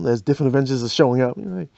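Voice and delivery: ordinary talk, nothing marked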